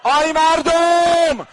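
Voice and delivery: high, loud and slow wailing chant; full-voice lament. Real, not acted. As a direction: wail it out to the whole shrine, slowly. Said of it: "حالا اگر داد میزنی".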